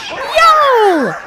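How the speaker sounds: high, loud yowl of pain; a giant rat's yowl of surprise and pain; monster yowl. Acted. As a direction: shriek it like a wounded beast.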